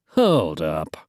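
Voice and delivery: ordinary delivery, nothing marked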